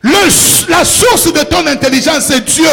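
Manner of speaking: shouted, high sermon climax; fervent, loud preacher's cry; full-force preaching. Real, not acted.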